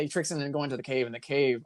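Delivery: normal talking voice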